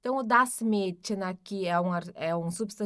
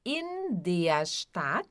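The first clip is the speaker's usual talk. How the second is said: In der Stadt drawn out slowly; slow demo; 3 semitones lower, much slower than usual. Shown as a demonstration. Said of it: "o in der quer dizer aqui na". (In der Stadt devagar)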